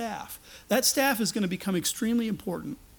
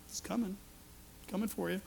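menacing mutter; 12 dB quieter, faster than usual. Retold or imitated. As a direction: murmur it like a man holding a stone. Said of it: "I could just see some of the guys".

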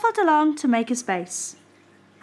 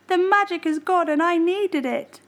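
mild sad cry; 7 semitones higher than usual. Acted. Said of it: "cried Bear".

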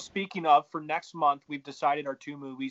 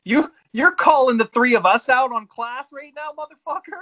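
loud, high-pitched outburst of disbelief; indignant challenge; 9 semitones higher, 10 dB louder than usual. Real, not acted. Podcaster challenging co-hosts who question his class, loudly indignant. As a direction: call them out, voice jumping up loud.

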